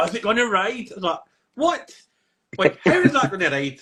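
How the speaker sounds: high-pitched, incredulous retold reaction; loud, high mock bafflement; mock disbelief. Acted baffled reaction to rigged game.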